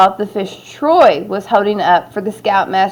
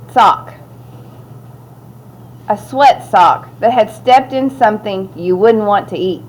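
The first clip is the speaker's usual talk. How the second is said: plain reading voice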